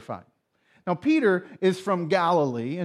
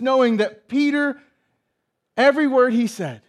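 loud, high-pitched sermon delivery; impassioned preaching; 7 semitones higher, 7 dB louder than usual. Real, not acted. Preacher telling how Jesus heard Peter's denial, impassioned preaching. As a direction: drive each phrase home at full volume.

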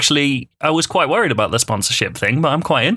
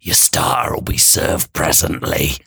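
low eerie chorus voice; low, sinister waiter chorus; doubled with whisper; 9 semitones lower than usual. Acted. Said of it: "all the voices said".

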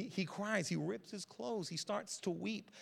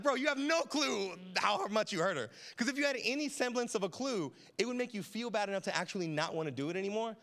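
exasperated; 5 semitones higher, 4 dB louder than usual. Retold or imitated. Exasperated dad to son.

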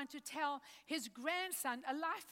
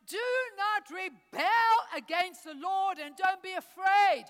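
commanding shout; 7 dB louder than usual. Acted. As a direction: proclaim it like a leader rallying. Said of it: "he says in verse eight".

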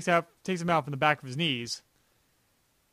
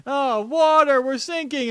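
high, loud mock panic; freaking out; 8 semitones higher, 6 dB louder, slower than usual. Retelling a villain seeing water flood in, freaking out. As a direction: wail it in exaggerated alarm, imitating a movie character. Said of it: "Deep Talk starts freaking out".

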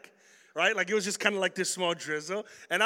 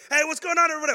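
much higher, loud showy hello; loud greeting; 10 semitones higher, 9 dB louder, much faster than usual. Retold or imitated. Walking into McDonald's to greet everyone, loud and high.

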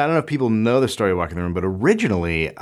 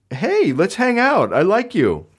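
much higher, goofy eager dog; happy dog voice; 9 semitones higher than usual. Retold or imitated. Dog answering its owner after a punch, in a high goofy voice. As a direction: play the dog as clueless and friendly.

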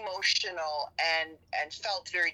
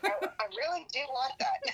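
laughing; 9 semitones higher than usual. Real, not acted. Laughing admission between co-hosts.

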